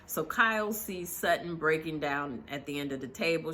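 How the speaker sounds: ordinary talk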